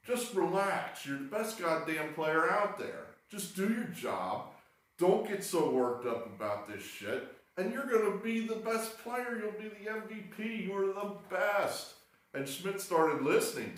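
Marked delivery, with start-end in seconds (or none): 0.1-11.9 higher, coaxing veteran's voice; pep talk. Retold or imitated. Pete Rose calming Mike Schmidt, higher coaxing pep talk. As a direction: talk him down like a confident mentor. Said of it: "he told Mike Schmidt".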